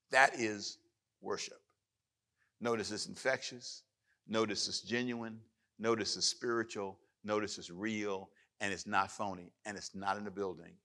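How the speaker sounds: normal talking voice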